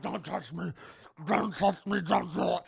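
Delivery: garbled mock-agonized creature; hammed-up mutant warning others off; melting monster voice. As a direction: plead through a melting mouth. Voicing the melting, mutating Charlie.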